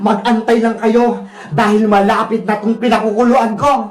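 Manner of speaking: low villain voice with evil cackles; gloating wicked vendor. Acted. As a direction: gloat darkly and cackle at your captives. Villain gloats over captives. (mababang boses ng masamang tindera, humahalakhak)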